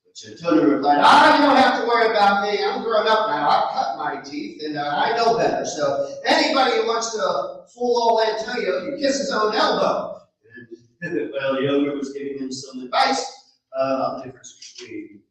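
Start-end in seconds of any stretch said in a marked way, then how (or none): 1.0-10.2 put-on young man's higher, louder voice, eager; a naive lad handing over his treasure; Antonio's voice. Antonio boasting.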